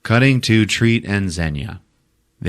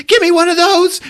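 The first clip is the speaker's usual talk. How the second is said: very high, loud demand; frantic character yell; 6 dB louder than usual. Retold or imitated. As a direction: squawk it in comic urgency. Grease Monkey grabs a grenade.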